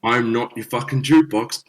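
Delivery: firm, emphatic retort; retold angry telling-off. Musician telling off a demanding customer, retold indignation. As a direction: put a pushy punter in their place.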